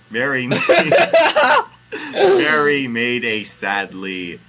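real laughter breaking into the words; laughing while reading. Real, not acted. Host narrating Mary's sadness, helplessly laughing. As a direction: crack up mid-sentence, then push on loudly. Reader cracks up at the text.

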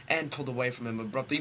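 ordinary talk

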